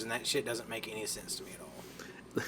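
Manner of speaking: unvoiced whisper. Real, not acted. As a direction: breathe it out as an aside. Drops to a whisper.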